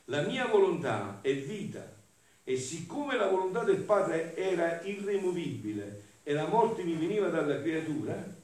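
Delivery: high, loud, measured declamation; impassioned devotional reading. Acted. Preacher reads Jesus' words on will and life, forceful declamation. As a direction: proclaim every phrase with full conviction.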